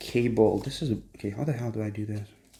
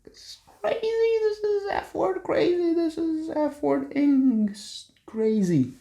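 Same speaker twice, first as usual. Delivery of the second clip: excited squeal. Real, not acted. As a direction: stretch it out in a high squeal. Amazed at the screen.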